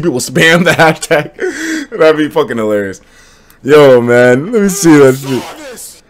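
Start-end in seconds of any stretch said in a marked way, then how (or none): none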